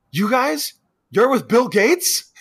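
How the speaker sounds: high-pitched indignant accusation; hammed-up threat, high and worked up; mock outrage. Imitating Buckley catching them in the shower, outraged.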